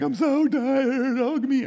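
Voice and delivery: high, loud, slow mock whimper; mock breakdown. To a stranger at Walmart, hammed-up tired plea. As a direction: play the overwhelmed friend begging for a hug. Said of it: "break down cry".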